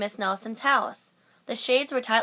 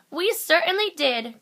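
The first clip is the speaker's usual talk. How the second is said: eager cry; 8 semitones higher, 5 dB louder than usual. A narrator's reading. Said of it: "cried all the kids".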